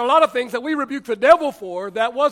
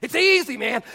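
ordinary talk

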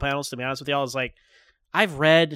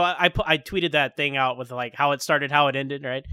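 ordinary talk

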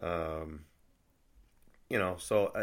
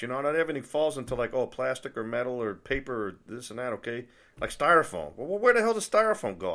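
higher and louder, fed-up complaining; exasperated rant; 7 semitones higher, 5 dB louder than usual. Real, not acted. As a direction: vent your frustration over the trash rules, voice climbing. Frustrated rant about trash rules.

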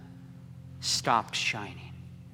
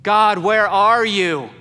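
slow, loud, higher plea to heaven; anguished cry; 7 semitones higher, 11 dB louder, slower than usual. Acted. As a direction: call out into the empty dark. Cry of a suffering soul.

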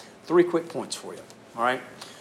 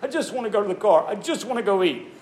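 put-on higher, whiny impatient child begging to leave; whiny kid; 6 semitones higher than usual. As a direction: play a bored kid tugging at his parents. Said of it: "You're like".

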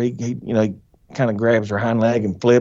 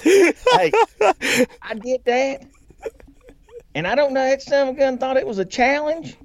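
talking high through real laughter; words bubbling up high, still laughing; 10 semitones higher than usual. Real, not acted. Still cracking up after squealing for the host, laughing talk.

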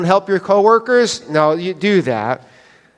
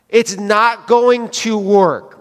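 slow, drawn-out doubt; slow doubtful warning; 3 semitones higher, much slower than usual. Retold or imitated. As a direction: spell out that it won't work, slowly. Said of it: "I'm like".

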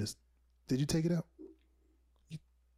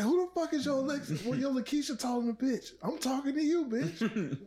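higher indignant woman's voice; lightly voiced woman; 8 semitones higher than usual. Woman in the skit snapping at the Alexa, higher. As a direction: play the angry girl, up high. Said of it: "she was like".

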